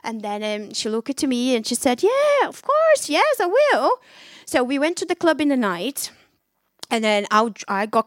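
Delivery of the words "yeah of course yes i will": much higher, slow sing-song yes; friend's sweet agreement. Retold or imitated. Friend calmly accepting the party invite, sweet. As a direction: chirp it brightly and slowly.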